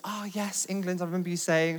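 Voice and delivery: acted-out delighted recognition; retold keen recognition. Leader's wife recognises him, retold.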